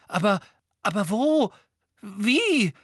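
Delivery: high, loud, stammered questions. A narrator's reading. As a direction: blurt it out in horrified alarm. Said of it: "fragte Hermine mit weißem Gesicht". (entsetzt, kreidebleich)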